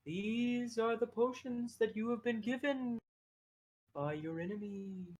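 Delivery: odd little creature solemnly pronouncing; little creature oracle. Acted. Game master plays Abel.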